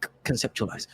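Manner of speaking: soft British voice, stumbling into the word; quiet British character voice; British accent. Acted. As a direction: stammer the first sound in a British accent. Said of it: "my British accent makes the R hard".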